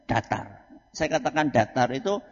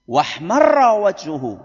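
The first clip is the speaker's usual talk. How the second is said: loud, raised and drawn out; fiery sermon; 4 semitones higher, 8 dB louder, slower than usual. Shown as a demonstration. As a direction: proclaim it slowly at full force. Demonstrating the Prophet's fiery sermon delivery. (khotbah lantang berapi-api)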